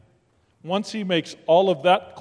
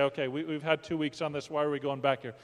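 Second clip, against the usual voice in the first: softer, quicker grumble of a listener; mock listener complaint; 2 semitones lower, 7 dB quieter, faster than usual. Preacher voicing a bored listener's grumble, softly and quickly. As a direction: toss off the objection under your breath.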